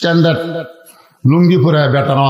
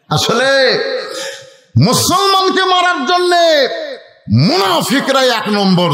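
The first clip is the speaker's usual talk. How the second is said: high, slow, drawn-out cry; impassioned preaching; 7 semitones higher, slower than usual. Real, not acted. Preacher blaming hypocrites before the crowd, high drawn-out preaching cry. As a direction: stretch the accusation high, then drop.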